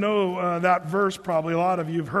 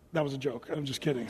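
quiet aside; 6 semitones lower, 8 dB quieter, faster than usual. Real, not acted. Aside after a teasing joke.

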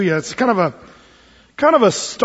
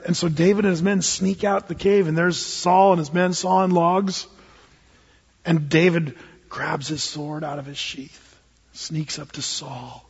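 ordinary delivery

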